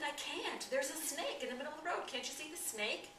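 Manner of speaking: high, fast, frightened pleading; panicked refusal. Retold or imitated. Frozen man pleads with the passerby, high and panicky. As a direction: beg him to see the danger.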